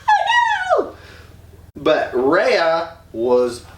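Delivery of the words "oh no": very high squealed oh no with a laugh; mock panicked squeal. Swallowed kids squealing in mock panic.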